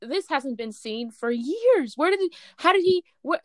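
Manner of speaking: high, excited, tripping over the questions; a fan too thrilled to finish a sentence; freaking out. Retold or imitated. Retelling the season finale reveal, excited high babble. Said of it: "I was freaking out".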